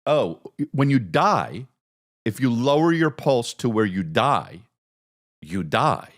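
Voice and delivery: ordinary talk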